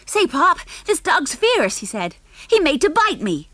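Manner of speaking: high, loud scared whine; alarmed whiny boy. Acted. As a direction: complain to Pop in a fright. Junior alarmed by growling dog.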